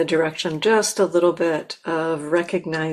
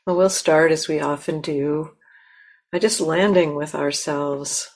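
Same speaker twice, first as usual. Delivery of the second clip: guided meditation. Acted. Teacher guiding the listeners to land in themselves, calm and unhurried. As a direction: gently lead them into stillness.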